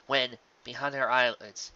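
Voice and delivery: usual speaking voice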